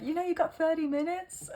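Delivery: a playful Irish lilt with a chuckle; American Irish accent. Retold or imitated. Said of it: "weird American Irish accent".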